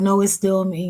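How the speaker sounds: imitating him with a laugh; his reply. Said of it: "He's like".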